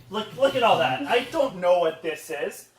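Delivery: ordinary delivery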